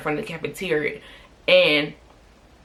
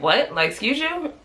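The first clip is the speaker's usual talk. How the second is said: retold incredulous comeback, rising high; offended disbelief; 7 semitones higher, slower than usual. Answering the girl who spread the rumor, offended disbelief. Acts out shocked reply to classmate.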